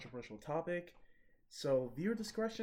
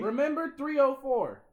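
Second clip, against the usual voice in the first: yelled high; loud callback; 11 semitones higher, 10 dB louder than usual. Real, not acted. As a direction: shout it back. Shouted repeat of inside joke.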